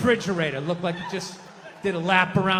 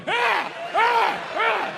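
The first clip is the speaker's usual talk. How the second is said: three high, loud primal screams; 5 dB louder, much slower than usual. Acted. Comedian opening with wild high screams. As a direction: scream it out to the sky.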